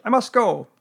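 high, loud animal character voice; brisk storybook busybody, pitched right up; Rabbit. A narrator's reading. Rabbit answering Pooh's greeting, high character voice.

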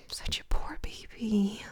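whispered fake sympathy; mock pity whisper. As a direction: coo it in a whisper.

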